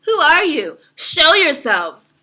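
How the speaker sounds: high, loud, slow call to unseen foes; mock challenge. Calling out to the faceless wands below, a loud mock challenge. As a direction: challenge the faceless crowd, dragging it out.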